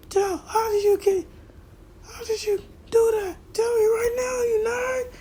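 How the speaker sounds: very high, strained screaming demand; furious shriek. Acted. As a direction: scream it like a raging rival. Bakugo launching at Deku.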